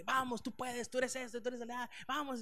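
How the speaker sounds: high pumped-up cheerleading; life coach hype. Retold or imitated. Preacher mocking life coach pastors, hyped cheerleading. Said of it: "tipo coacheo nomás".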